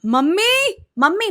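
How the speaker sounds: high, loud call upstairs; panicked call up the stairs; calling out for mom. Retold or imitated. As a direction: yell for mom from downstairs. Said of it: "उसने चिल्ला दिया".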